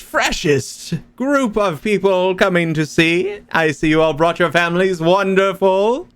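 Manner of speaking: high, far, sing-song ringmaster; bright squeaky sing-song welcome. Acted. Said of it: "and you hear him say".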